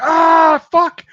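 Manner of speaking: loud high yell of pain; mock pained yell. Imitating Dan cursing after the shock, loud pained yell. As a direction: shout the curse like it hurts.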